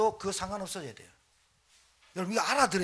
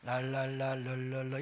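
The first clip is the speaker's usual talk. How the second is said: unhurried, softer la-la babble; slow tongues babble; 5 semitones lower, 6 dB quieter than usual. Shown as a demonstration. 천천히 했어야 할 방언을 느긋하게 보여주는 소리. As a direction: draw out the babble gently. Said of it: "천천히 할 걸".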